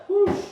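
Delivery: loud forceful whoosh of breath; short, loud puff of relief; sharp exhale. Real, not acted. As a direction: blow out hard as the set ends. Blowing out as buzzer goes.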